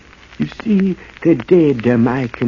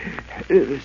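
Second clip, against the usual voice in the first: mad cackle; 3 semitones lower, 6 dB quieter than usual. Acted. Madman laughing alone in tomb.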